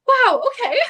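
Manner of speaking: high, loud disbelief with a laugh; incredulous. Retold or imitated. Reacting to a cold brush-off, incredulous.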